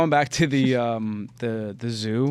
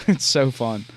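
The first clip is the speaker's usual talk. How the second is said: usual speaking voice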